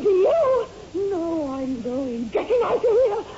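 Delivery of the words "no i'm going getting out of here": tearful. Acted. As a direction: choke it out through tears. Wife leaves in tears.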